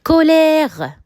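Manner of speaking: colère with the open è drawn out; example word said clearly. Shown as a demonstration. Teacher saying an example word to the class, clearly. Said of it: "Par exemple".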